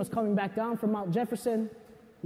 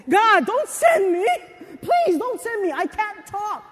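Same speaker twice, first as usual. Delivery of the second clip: higher and louder, slow begging; pleading Moses; 8 semitones higher, 5 dB louder, slower than usual. Retold or imitated. Moses begging God not to send him, pleading.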